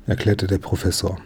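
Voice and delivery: usual speaking voice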